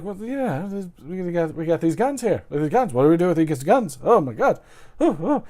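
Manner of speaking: put-on high-pitched flustered British accent; Eddie Izzard. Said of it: "my take on the British accent".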